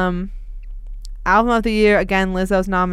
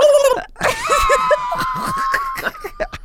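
screaming turkey noise. Acted. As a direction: shriek out a turkey call. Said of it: "I'm just, like, screaming".

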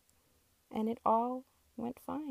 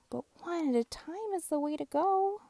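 higher, bright little truck voice; high little truck giving advice; Little Blue's voice; 9 semitones higher than usual. Acted. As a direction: teach them in a high kind voice.

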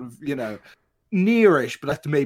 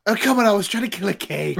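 louder, higher mock-indignant plea; mock protest; 6 semitones higher, 4 dB louder, faster than usual. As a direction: protest in playful exasperation. Character protests at hard look.

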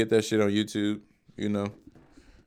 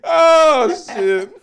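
laughing; 10 dB louder than usual. Real, not acted. Laughing at joke.

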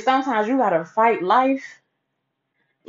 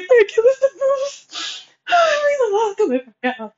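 much higher, slow, sobbing thanks; slower than usual. Retold or imitated. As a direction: break down crying while thanking him. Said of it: "I ended up crying some more".

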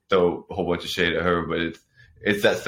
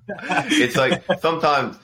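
laughing; 9 semitones higher, slower than usual. Real, not acted. Laughing while admitting it is dumb.

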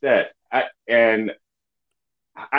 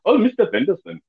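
higher-pitched Indian therapist voice; Indian accent; 5 semitones higher than usual. Acted. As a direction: slip into a put-on Indian accent. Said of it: "As soon as I slip into it".

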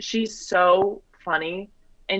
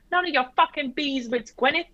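put-on Australian accent, cheeky comeback; 8 semitones higher than usual. Telling off nosy Gwyneth, higher mock Australian accent. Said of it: "you could do an Aussie accent".

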